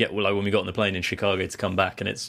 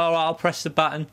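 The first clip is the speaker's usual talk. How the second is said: higher mock woman's voice; British woman in the lift; 6 semitones higher than usual. British woman in the lift agreeing, higher mock voice. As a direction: play her as a cheerful stranger. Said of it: "She's like".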